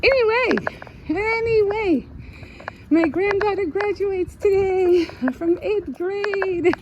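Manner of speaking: high, slow, beaming announcement; excited announcement. Real, not acted. Telling viewers her granddaughter graduates today, high and proud. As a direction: lift it up proudly and slowly.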